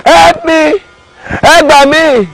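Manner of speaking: high-pitched calls for help from the hole; shouting for help. Acted. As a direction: cry out Help me from inside the pit. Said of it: "He was now shouting".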